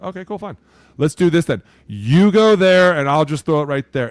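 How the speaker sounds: fine, I'll just pick you apart; cocky. Retold or imitated. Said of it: "Jalen was like".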